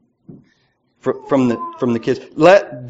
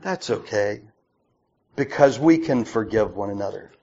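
much softer, lower reassurance; gentle forgiveness; 4 semitones lower, 4 dB quieter than usual. Retold or imitated. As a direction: forgive him gently and quietly. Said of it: "We say".

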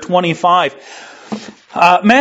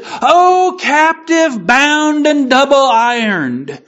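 high wailing ghost voice; crying ghost; 6 semitones higher, slower than usual. Acted. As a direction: wail it like a chained phantom. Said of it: "cried the phantom".

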